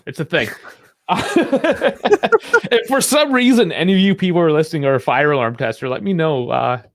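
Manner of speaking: ordinary delivery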